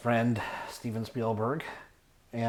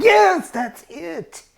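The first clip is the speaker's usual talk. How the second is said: high, slow squeal of recognition; excited friend; 10 dB louder, much slower than usual. Retold or imitated. Friend confirming the movie, high slow squeal. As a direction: stretch it out high and loud like a thrilled girl. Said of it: "And she's like".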